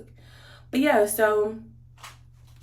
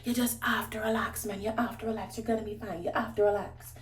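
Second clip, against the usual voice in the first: softer, soothing husband voice; quiet reassuring imitation of husband; imitating calming husband; 7 dB quieter than usual. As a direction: hush her gently like a husband calming his wife. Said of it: "he's trying to, like, calm me down".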